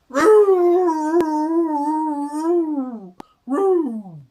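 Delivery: high, loud drawn-out yowl; cat yowl. Acted. Stuck cat howls down to the dogs, high. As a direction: howl like a cat. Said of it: "the sound that echoed around".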